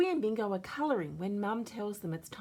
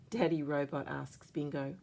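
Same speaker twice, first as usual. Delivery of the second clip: ordinary delivery, nothing marked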